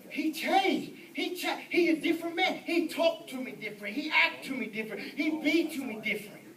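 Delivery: lightly voiced excited wife; wife's voice. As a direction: imitate an emphatic wife vouching for him. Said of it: "But she go".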